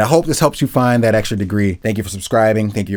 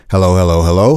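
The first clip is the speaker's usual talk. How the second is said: close-up mic hello; 5 semitones lower, 5 dB louder than usual. Shown as a demonstration. Testing the mic up close for proximity effect. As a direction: lean right into the mic. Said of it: "getting a little closer so you can kinda get that proximity effect".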